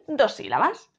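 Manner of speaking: ordinary talk, nothing marked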